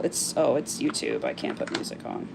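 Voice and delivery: breathy whisper to herself after realizing YouTube rules; half-swallowed whisper of disappointment; whispered aside. Real, not acted. Whispering to herself on her YouTube live, realizing she can't play music.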